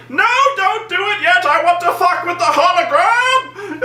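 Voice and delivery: high, overwrought villain yell; mock villain countermanding his own order; Zod ranting. Zod toying with the hologram, ranting shout. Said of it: "threatening the hologram".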